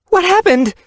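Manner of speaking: high, loud alarmed question. Acted. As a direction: rush in alarmed. Alarmed mother runs to hurt kit.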